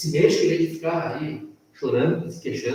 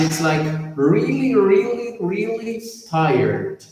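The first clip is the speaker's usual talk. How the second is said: each "really" rising, the last one high, then "tired" slowly; building emphasis; 7 semitones higher, slower than usual. Shown as a demonstration. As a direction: pile up the "really"s to show how tired. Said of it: "Weary we have".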